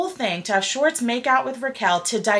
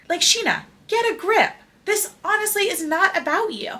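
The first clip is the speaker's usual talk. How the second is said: scolding; 9 semitones higher than usual. Real, not acted. Scolding Scheana directly.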